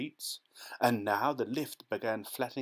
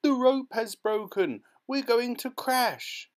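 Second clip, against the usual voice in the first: high, loud, slow terrified wail; panicked cry; 12 semitones higher, 6 dB louder than usual. A narrator's reading. Mrs Teavee shrieking in the plunging lift, high and loud. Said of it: "Missus Teavee cried out".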